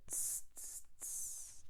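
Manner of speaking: soft unvoiced hissing tss sounds; monkey tsk. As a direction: tut back like a cheeky monkey.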